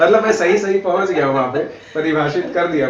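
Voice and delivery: loud delighted laugh while talking. Real, not acted. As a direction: laugh it out, pleased with yourself. Delighted he guessed right.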